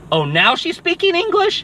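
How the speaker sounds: high, put-on astonished voice; mock surprise. Character mocking the girl's sudden English, high mock surprise. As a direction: gasp it out like you can't believe she talks. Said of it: "or she's like".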